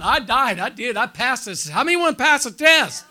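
high, loud preacher's cry; preaching at full force. Real, not acted. Preacher rousing the congregation, loud and high.

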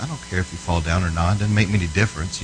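low, soft, retold brush-off; offhand dismissal. Preacher answering girl who won't fall down, offhand dismissal. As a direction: toss it off quietly, unbothered.

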